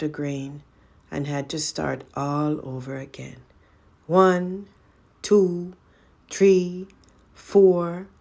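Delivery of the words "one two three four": counting. Acted. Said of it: "she counted".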